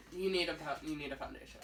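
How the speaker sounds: lightly voiced deadpan makeup guy, low and quiet; a hint of a grave, unsmiling salesman; serious makeup man. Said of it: "'Cause he was so serious".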